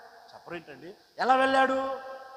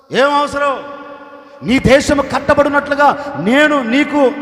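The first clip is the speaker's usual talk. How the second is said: loud royal command rising to a shout; the king booming his permission; king loudly ordering Nehemiah to go and rebuild; 4 semitones higher, 8 dB louder than usual. Acted. King commanding, rebuking grief.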